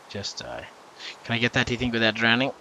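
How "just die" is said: much lower and softer; quiet, dropped-down repeat to yourself; low muttered plea. Real, not acted. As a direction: mutter it low under your breath. Repeats wish while fighting creeper.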